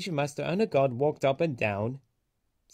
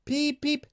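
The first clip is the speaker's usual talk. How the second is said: much higher, a sung peep peep; whistle imitation; 13 semitones higher than usual. A narrator's reading. Thomas whistles to the waving men, high. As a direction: whistle it like a train. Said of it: "whistled Thomas".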